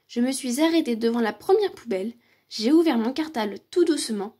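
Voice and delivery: ordinary delivery, nothing marked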